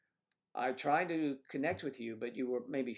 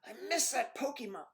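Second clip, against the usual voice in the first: much higher wife voice, whining; mock wife whine; 13 semitones higher, 3 dB louder than usual. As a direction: wail it like a disappointed kid.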